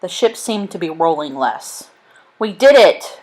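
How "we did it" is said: loud, high joyful call; triumphant shout. A narrator's reading. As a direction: call down to the men below. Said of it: "they shouted to the men below".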